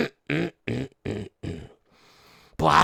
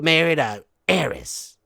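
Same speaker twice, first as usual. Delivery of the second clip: ordinary delivery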